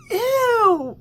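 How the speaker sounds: high, loud disgusted squeal; loud grossed-out ew. Real, not acted. Disgusted reaction to age gap.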